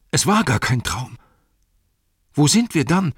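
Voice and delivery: loud, high cry of disbelief. Acted. Sam wakes and realises it was no dream, loud high cry. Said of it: "laut rief er aus".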